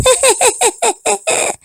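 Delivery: high cheeky cookie laugh; high taunting laugh. Acted. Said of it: "just laughed".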